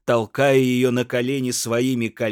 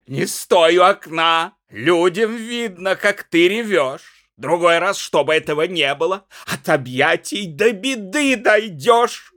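higher, louder rambling reproach; drunken hectoring; 8 semitones higher, 7 dB louder than usual. A narrator's reading. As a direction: scold her, then fumble, softening at the end. Пьяный муж отчитывает жену. (пьяное ворчливое поучение в крик)

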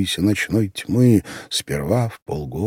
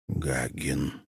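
ordinary delivery, nothing marked

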